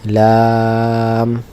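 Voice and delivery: লাম with a very long, level vowel; drawn-out letter name. Shown as a demonstration. Teacher models the letter name for students, drawn out. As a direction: stretch the vowel of লাম and close on m. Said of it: "আমরা একটু টেনে বলি".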